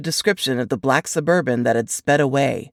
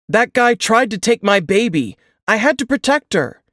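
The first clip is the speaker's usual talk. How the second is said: higher, louder, urgent pleading; agitated father; 6 semitones higher, 4 dB louder than usual. Acted. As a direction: insist he only protected his baby. Father defends himself to cop.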